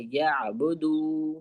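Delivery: یعبدو very slow, gliding without any jerk; teacher shows the smooth ain; smooth, no jolt. Shown as a demonstration. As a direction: ease through یعبدو with no catch. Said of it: "جھٹکا بالکل نهیں آئے گا".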